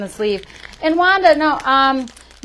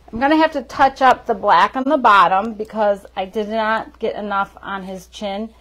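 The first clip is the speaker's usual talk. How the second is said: ordinary talk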